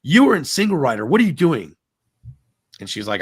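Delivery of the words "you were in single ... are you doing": loud, high accusing yell; retold yelling. Cast member yells at wife in line, accusing yell. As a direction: imitate an angry worker yelling at a guest. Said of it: "he yells at her and says".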